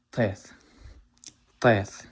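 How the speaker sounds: Teth starting on a tongue-back emphatic t; Teth, heavy t at the front, th at the end. Shown as a demonstration. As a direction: say Teth with an Arabic-style emphatic t. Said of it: "an emphatic T".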